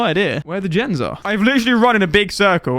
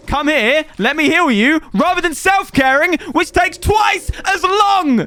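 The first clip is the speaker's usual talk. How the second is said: mocking exasperation; 10 semitones higher, 4 dB louder, slower than usual. Real, not acted. Mocking teammate's heal choice.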